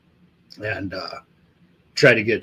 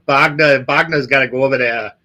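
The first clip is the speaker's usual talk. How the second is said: put-on Boston tough talk; Boston accent with dropped Rs; 2 semitones higher than usual. Shown as a demonstration. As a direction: riff in a broad Boston accent. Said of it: "doing fucking Boston fucking accents".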